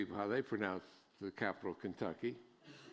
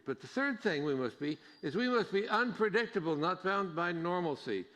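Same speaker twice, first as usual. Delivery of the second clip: louder, higher preaching, slowing on the key lines; full-force preaching; 7 semitones higher, 6 dB louder than usual. Acted. Pastor urging the congregation to accept outsiders, preaching hard. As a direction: drive the point home from the pulpit.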